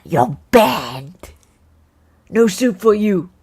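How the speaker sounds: loud, put-on dictatorial dismissal; hammed-up banishing decree; mock tyrant. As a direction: bellow it like a petty tyrant. Quotes sitcom tyrant, mocking the judge.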